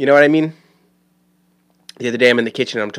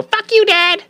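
very high mock child's yell; defiant kid outburst; slower than usual. Kid sent to their room, high mock defiant squeal. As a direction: hurl it like a bratty kid, pitch shot way up. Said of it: "go to your room and you go".

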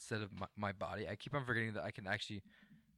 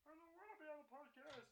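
Kermit the Frog; 19 dB quieter than usual. Acted. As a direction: whimper like Kermit.

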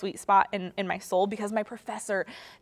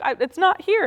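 confused student; 9 semitones higher, 7 dB louder than usual. Retold or imitated. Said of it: "And they'll be like".

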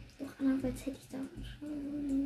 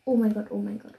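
sudden loud panicked cry; panicked shout; 4 semitones lower, 8 dB louder, faster than usual. Real, not acted. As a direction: blurt it out in shock. Falls down, creeper approaching. (panischer Ausruf)

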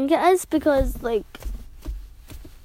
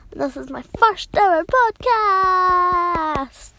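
higher, drawn-out proud announcement; excited announcement; 4 semitones higher, slower than usual. Real, not acted. Ten-year-old telling listeners about a first podcast, excited announcement. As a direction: announce it big, stretching the last word long.

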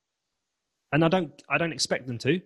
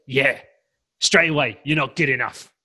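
a louder, snide imitation of the inner critic; mocking inner critic; 5 dB louder than usual. Coach voicing a client's inner critic, a touch of snide mockery. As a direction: sneer it as the voice that says you're not good enough. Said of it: "the unsupportive voice goes".

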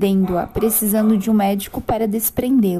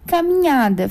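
ordinary talk, nothing marked

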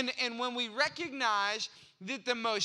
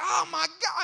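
loud, high mock cry of pain; hammed-up pained holler; retold yell; 7 semitones higher, 7 dB louder than usual. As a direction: yelp it out, reliving the jolt. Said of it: "I yelled".

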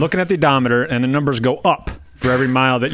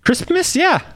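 squeaky high. Acted. Cohost squeaks answer.

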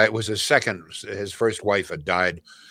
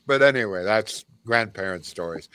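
usual speaking voice

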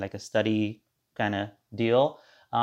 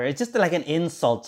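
ordinary talk, nothing marked

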